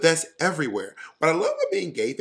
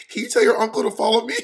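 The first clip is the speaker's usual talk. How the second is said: put-on high, fast little girl voice; kid voice; 8 semitones higher, 4 dB louder, faster than usual. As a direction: voice an eager kid. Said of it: "her friend was like".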